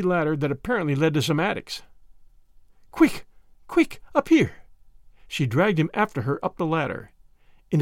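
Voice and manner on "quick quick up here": quick, high, pressing little bursts; mildly voiced girl, urgent and high; urgent command. A narrator's reading. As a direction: urge him to hurry up the ladder. Annette urges Tommy up ladder.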